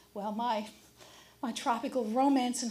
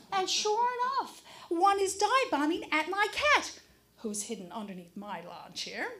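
normal talking voice

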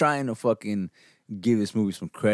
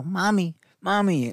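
imitating little girl; 8 semitones higher, slower than usual. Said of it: "they kept fucking saying".

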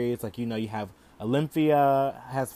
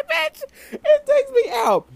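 much higher shrieks, laughing till near tears; screaming laughter; 15 dB louder, slower than usual. Real, not acted. Host cracks up at her [name] quote, shrieking. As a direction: laugh so hard it breaks into squeals.

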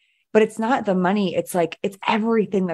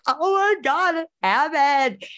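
high, tearful voice reliving the moment; retold crying; 9 semitones higher, much slower than usual. Messaging her mentor after hitting a million, tearful high voice. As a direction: squeak it out as if choking up with tears. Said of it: "I was, like, bawling my eyes out".

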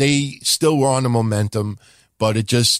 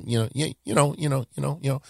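quick mumbled "you know, you know"; mocking filler habit; 2 semitones higher, 6 dB quieter, faster than usual. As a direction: parody the stammering filler. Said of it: "saying, you know".

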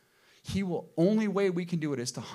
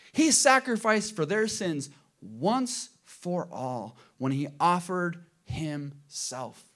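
slow, louder scripture read; 5 semitones higher, 7 dB louder, slower than usual. Acted. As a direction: read the verse with weight, slowly. Reads Hebrews aloud.

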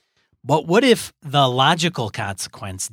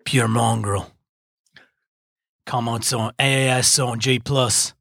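bad Australian accent; 2 semitones lower than usual. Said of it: "in really bad accents".

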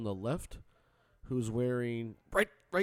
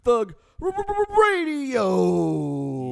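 loud, high stuttered radio call; hype show call; 6 semitones higher, 10 dB louder, much slower than usual. Acted. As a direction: belt out the show name with stutter.

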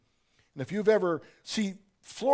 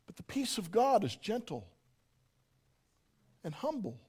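softer and softer, tender; gentle fade; 4 semitones higher, 5 dB quieter than usual. Acted. Preacher describing God's peace, gently fading. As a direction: let it fall to almost nothing.

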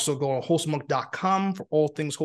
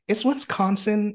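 slow, raised-pitch mock talk-show delivery; 6 semitones higher, slower than usual. Host teasing guest's careful pronunciation, mock talk-show voice. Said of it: "like you're on a talk show".

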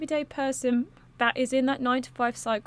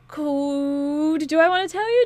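teasing; 4 semitones higher, 7 dB louder than usual. Real, not acted. Teasing the viewers with a secret.

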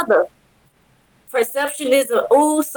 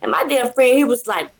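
usual speaking voice

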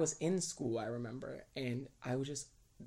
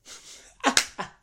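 embarrassed laugh; 12 semitones higher, 9 dB louder than usual. Real, not acted. Laughing at his old lyrics.

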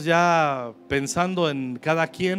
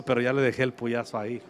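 ordinary talk

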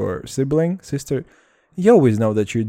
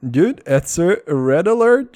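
mock alarm; 7 semitones higher, 5 dB louder than usual. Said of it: "warning himself".

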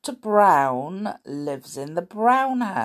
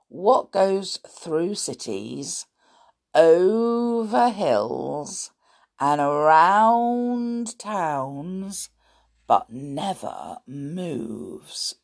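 riddle reading; slower than usual. Acted.

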